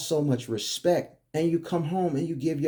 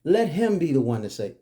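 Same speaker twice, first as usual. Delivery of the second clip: ordinary talk, nothing marked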